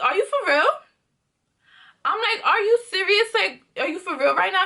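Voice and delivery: can't believe what she hears; disbelief. Retold or imitated. Disbelief at her ex's demand.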